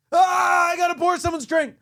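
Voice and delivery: sudden high, loud startled yell; waking in panic. Retold or imitated. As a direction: jolt awake yelling, fast. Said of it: "I'm sleeping, and then I'm just like".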